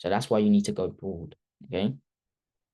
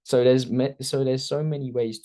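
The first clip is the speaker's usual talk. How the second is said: laughing; 4 semitones higher, 6 dB louder than usual. Real, not acted. Laughing apology mid-lesson.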